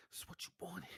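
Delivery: soft teasing whisper. Acted. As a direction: whisper it very quietly. Whispered repeat of teasing line.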